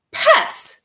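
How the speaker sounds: high breathy shocked question. Acted. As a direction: gasp the word out in disbelief. Wife shocked at penguin idea.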